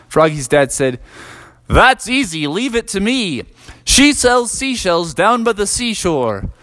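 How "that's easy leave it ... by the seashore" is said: higher, put-on silly dad voice; a boastful dad in a higher cartoon voice; crazy dad voice. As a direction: rattle off the tongue twister, showing off. Crazy dad nails the tongue twister.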